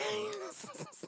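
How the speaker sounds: high-pitched giggle. Real, not acted. Laugh after greeting.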